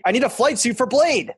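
pitched high and whiny; escalating whine. Pretend. Repeated, escalating.

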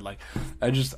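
normal talking voice

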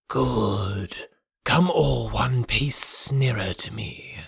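slow whispery snake hiss; Kaa. A narrator's reading. Python drawing the monkeys nearer, hissed slowly. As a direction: lure them in with a long, hushed hiss. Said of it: "hissed Kaa".

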